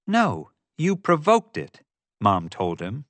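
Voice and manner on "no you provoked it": unsympathetic. A narrator's reading. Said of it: "without an ounce of sympathy".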